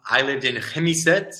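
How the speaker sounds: normal talking voice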